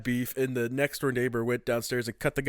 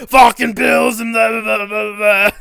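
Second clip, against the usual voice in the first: loud, high mock rant; hammed-up furious husband tirade; imitated yelling neighbor; 9 semitones higher, 15 dB louder than usual. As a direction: shout it, running off into blah-blahs. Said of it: "like, yelling at his wife".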